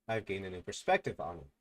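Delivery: ordinary talk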